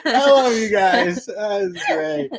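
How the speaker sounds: slow, high, through the tail of laughter; real laughter winding down as you speak; catching breath from a good laugh. Real, not acted. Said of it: "making me laugh".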